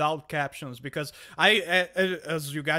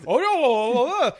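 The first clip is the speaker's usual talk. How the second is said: put-on high, loud gibberish take on a British accent; incomprehensible British accent; 9 semitones higher, 9 dB louder than usual. Baffled guest mimicking Az's British speech, incomprehensible British accent. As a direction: babble a British accent, much louder and higher. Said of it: "I couldn't understand a word of what Az was saying".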